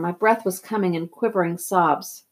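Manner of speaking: ordinary delivery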